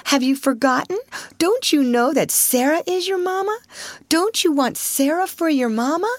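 high-pitched, coaxing questions; sweet, upbeat lilt pitched well up; cheerful. A narrator's reading. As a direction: cheer up a tearful child. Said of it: "said Sarah cheerfully".

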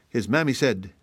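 ordinary delivery, nothing marked

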